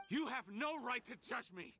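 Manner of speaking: high-pitched angry protest; indignant outburst. Acted. Villain rejecting his accuser's judgement, indignant. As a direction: lash out, pitch jumping high.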